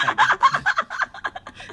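very high, loud breathy shriek of laughter. Real, not acted.

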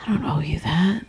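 hushed retort under the breath; whispered comeback to a rude stranger. Retold or imitated. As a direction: mutter it back, barely voiced. Retold retort to rude man.

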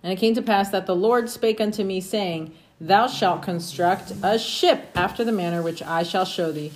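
normal talking voice

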